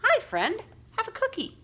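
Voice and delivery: very high, sweet little voice; friendly cartoon voice. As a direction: offer the cookie like a cartoon pal. Superhero drawing voiced friendly.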